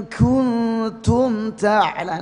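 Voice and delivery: stretched recitation, the k in "kuntum" leaking breath; over-hissed kaf. Shown as a demonstration. Teacher showing a reading that is clearly wrong, over-hissed chant. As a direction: chant "kuntum ta'la" long and high with an airy, loose k. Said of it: "tidak memperhatikan sifat syiddahnya".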